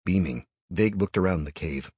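usual speaking voice

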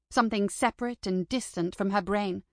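ordinary delivery, nothing marked

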